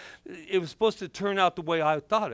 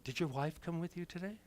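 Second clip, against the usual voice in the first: much softer, grave and careful; hushed doctor's question; 13 dB quieter than usual. Retold or imitated. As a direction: ask it quietly, breaking bad news. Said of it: "This is what she said".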